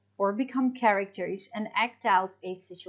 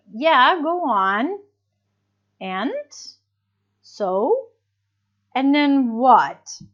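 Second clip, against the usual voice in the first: slow, louder, drawn-out prompts; encouraging questions; 4 semitones higher, 6 dB louder, slower than usual. Shown as a demonstration. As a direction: urge them on, eager to hear more. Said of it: "to encourage the speaker to keep talking".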